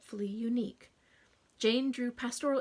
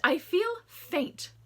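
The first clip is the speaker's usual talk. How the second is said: swooning; 8 semitones higher, 6 dB louder, much slower than usual. Acted. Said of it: "I think that my".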